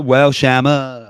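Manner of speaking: drawn-out mock scolding. Friend teasing Joe by stretching his nickname slowly and loudly. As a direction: drag out Shammer like a teasing reprimand.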